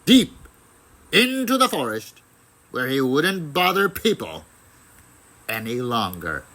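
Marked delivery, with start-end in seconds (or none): none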